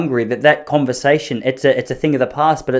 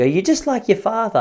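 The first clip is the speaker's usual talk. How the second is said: mock scolding mum; 7 semitones higher than usual. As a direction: needle him like mum.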